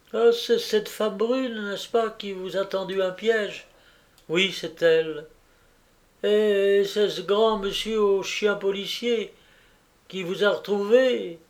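a kindly landlady fussing with relief; fussy landlady. Acted. Landlady greeting Jacqueline home, gushing relief.

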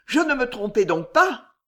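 high, loud terrified outcry. Acted. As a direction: fear confirmed. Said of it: "au comble de l'épouvante".

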